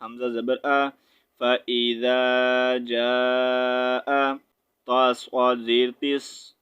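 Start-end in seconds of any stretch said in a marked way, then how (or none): 1.4-4.4 slow, drawn-out chanted recitation; Quran recitation. Shown as a demonstration. Teacher showing students how the verse is recited, slow chant. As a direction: recite it slowly like in prayer.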